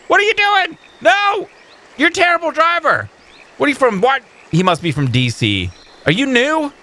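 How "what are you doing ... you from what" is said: high exasperated yell. Real, not acted. Player yelling at a clumsy in-game driver. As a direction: shout at him like he cut you off.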